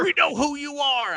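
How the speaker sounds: mocking squeaky voice; mock them high and shrill. Mocking villains' line.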